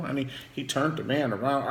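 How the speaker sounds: normal talking voice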